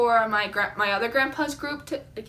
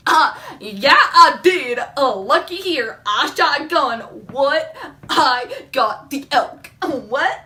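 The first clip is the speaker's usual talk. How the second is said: loud, hammed-up swagger with a laugh; mock show-off; cocky bragging; 2 semitones higher, 6 dB louder, much slower than usual. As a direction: boast it loudly, drawing out the words. Acted bragging about her elk.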